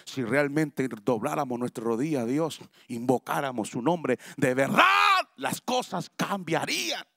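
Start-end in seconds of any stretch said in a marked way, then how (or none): none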